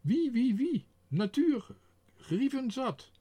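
high, loud calling to the guests. A narrator's reading. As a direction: call out across the garden. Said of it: "hij riep naar de gasten".

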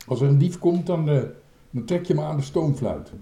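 loud shout back to the boat; called-out instruction. A narrator's reading. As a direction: call the order over your shoulder. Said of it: "riep Dikke Druif".

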